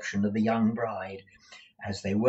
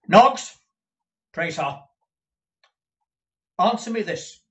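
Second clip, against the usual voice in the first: loud, higher bark of a name; sharp demand; 8 semitones higher, 10 dB louder than usual. Acted. Employer demanding an answer from Newman, loud. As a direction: snap his name to get attention.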